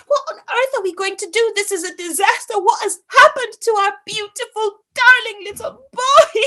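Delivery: high, loud distress; the parents despairing to the postman, high and loud. Acted. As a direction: cry out in panic. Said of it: "And they said".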